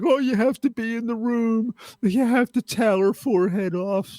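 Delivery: high, far-off mock preachy voice; mocking Phil Donahue. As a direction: sneer it out like a know-it-all TV host.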